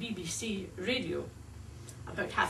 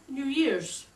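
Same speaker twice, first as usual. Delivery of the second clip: American "New Year's"; 6 semitones higher, 4 dB louder than usual. Shown as a demonstration. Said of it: "They call New Year".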